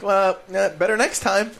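high, offhand mock brush-off; dismissive shrug. Mocking the US as it shrugs off Britain's plea, dismissive. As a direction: toss it off like it's nothing. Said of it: "the United States is like".

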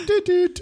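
high, short toots; imitated car horn. Podcaster imitating Herbie's horn for co-hosts, high toots. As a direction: toot like a little cartoon car. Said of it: "I can't remember what it sounded like".

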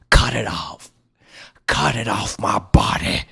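whispered, urgent cry of a sick man; dying man hissing for release; desperate plea. A narrator's reading. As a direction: beg to have it cut away.

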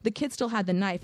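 ordinary delivery

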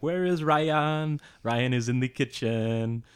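put-on high, slow French-accented English. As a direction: imitate French girls mispronouncing. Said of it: "they'd be like".

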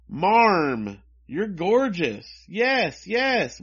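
high, loud cooing over a dressed-up pet; mock gushing. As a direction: gush in drawn-out, swooping exclamations. Voices girls gushing over the dog.

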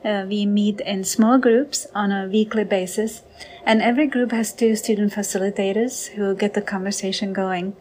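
usual speaking voice

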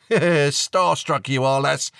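amused father ribbing his daughter; laughing dad. A narrator's reading. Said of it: "said her dad, laughing".

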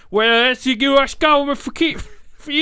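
high, loud, mangled mock British; awful British accent. Shown as a demonstration. Agent on the government, in grotesquely thick British. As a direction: yowl it in overdone British. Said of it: "even worse".

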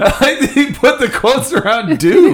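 words squeezed out through real laughter, slowed; laughing. Real, not acted. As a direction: laugh while getting the line out. Laughing at the review quote.

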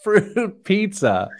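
high, slow laughing repeat. Real, not acted. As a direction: laugh through the words.

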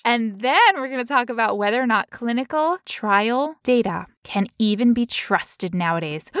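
ordinary delivery

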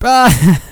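high, loud "Ah!" breaking into laughter; loud laughing outburst. Real, not acted. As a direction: burst out in a high laughing cry. Losing it after the failed accent.

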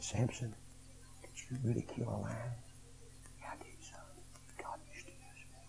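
quiet. Acted. Boy questions old Samson, he answers.

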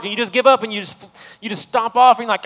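loud, high, fervent preaching to the church; full-force preaching. Real, not acted. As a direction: lift the room, preach it loud to the congregation.

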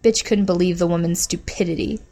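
plain reading voice

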